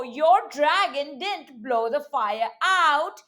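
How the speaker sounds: usual speaking voice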